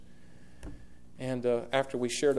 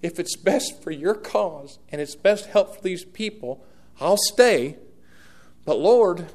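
higher and louder, slow and fervent; Paul's fervent plea; 5 semitones higher, 8 dB louder, slower than usual. Retold or imitated. Preacher voicing Paul pleading with the Lord, loud. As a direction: cry it out like an impassioned apostle. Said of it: "He's saying".

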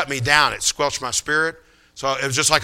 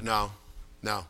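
low, very soft no; gently decline, barely above a murmur; hushed, polite bowing out; gracious refusal; 6 semitones lower, 15 dB quieter than usual. Retold or imitated. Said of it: "very graciously, he bowed out".

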